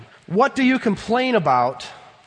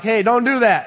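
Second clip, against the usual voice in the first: stern parent; 5 semitones higher, 6 dB louder than usual. Retold or imitated. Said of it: "when I'm serious".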